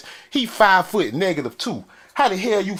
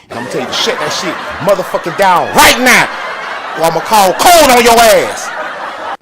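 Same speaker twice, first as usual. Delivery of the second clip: loud angry yell at the neighbors; 4 semitones higher, 11 dB louder than usual. Real, not acted. Yelling at hammering neighbors to shut it down. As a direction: shout them down, half laughing.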